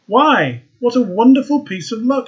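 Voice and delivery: high, loud delighted exclamation; excited cry. A narrator's reading. Student finding the red rose at his window, delighted cry. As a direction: burst out with joy at a lucky find. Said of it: "he cried".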